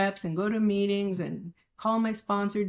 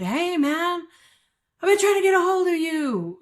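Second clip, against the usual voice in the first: lightly voiced dealer; 7 semitones higher, 5 dB louder than usual. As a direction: play an old dealer calling up. Voicing old dealer on the phone.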